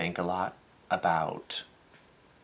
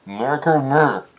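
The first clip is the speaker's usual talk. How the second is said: calling out a name; 6 semitones higher, 8 dB louder than usual. Retold or imitated. Court official calling a name.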